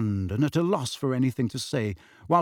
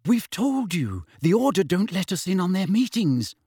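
nervous; 6 semitones higher than usual. A narrator's reading. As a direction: tread carefully, anxious not to anger him. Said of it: "said Hermione nervously".

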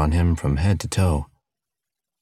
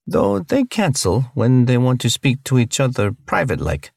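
higher-pitched alien, broken English, unhurried; alien cellmate; 7 semitones higher than usual. Acted. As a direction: explain patiently as a friendly alien, pitched up. Alien explains the translation spell.

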